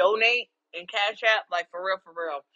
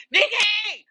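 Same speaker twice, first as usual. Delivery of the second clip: much higher, loud call across the street; calling out a name; 6 dB louder than usual. Retold or imitated. Neighbor spotting her outside, loud high calling. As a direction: hail her from a distance. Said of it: "people wanna holler at my name".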